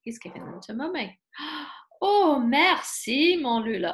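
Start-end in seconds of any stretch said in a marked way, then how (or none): 2.0-3.9 mother's thanks. Acted. Mother rabbit thanks him.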